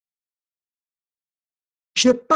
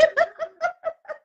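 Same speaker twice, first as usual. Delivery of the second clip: soft high giggle; 5 dB quieter than usual. Real, not acted. Laughing at rival.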